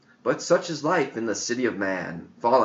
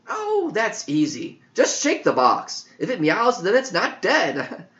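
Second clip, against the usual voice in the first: put-on high, eager boyish voice; bright-eyed kid blurting an answer, voiced high; young John; 8 semitones higher than usual. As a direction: play the clever boy, pitched up high. John's voice in story.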